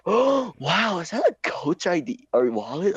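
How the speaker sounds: high-pitched mock amazement; gushing impressed classmate. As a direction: mimic a classmate gushing over a wallet. Retelling classmate gushing over wallet.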